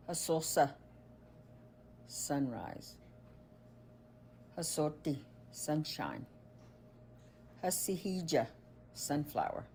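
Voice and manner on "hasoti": ordinary talk, nothing marked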